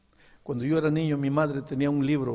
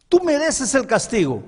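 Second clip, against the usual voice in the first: high, loud declaration; earnest mother; 11 semitones higher, 8 dB louder than usual. Acted. Mother telling her son he deserves punishment, loud and high. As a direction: proclaim it with full force.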